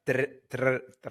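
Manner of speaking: tr- as a trill straight after the T; imitated rolled R. Comic working out how host starts travel, trilled tr-. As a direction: practise tr- with a rolling R, stopping short. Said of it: "it starts at the beginning".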